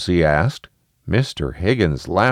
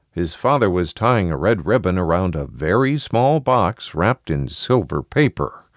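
ordinary delivery

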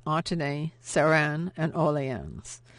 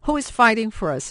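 bitter; 5 semitones higher, 5 dB louder than usual. Acted. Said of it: "he said bitterly".

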